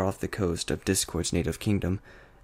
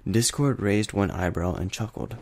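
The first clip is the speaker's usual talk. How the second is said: ordinary delivery, nothing marked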